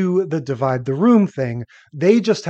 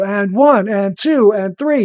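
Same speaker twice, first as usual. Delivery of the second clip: imitated workout count, loud and higher; 4 semitones higher, 5 dB louder than usual. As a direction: count out reps obnoxiously loud. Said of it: "she has to count really loudly".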